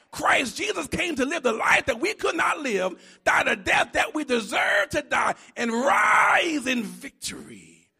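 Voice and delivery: loud, ringing preacher's cry; full-force preaching. Acted. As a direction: preach it out to the back row.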